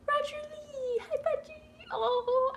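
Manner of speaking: very high, small squeak; touched, teary greeting. Real, not acted. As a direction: squeak hello on the edge of tears. Moved on seeing a donor's name.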